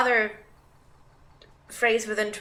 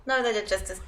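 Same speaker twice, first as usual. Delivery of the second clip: ordinary delivery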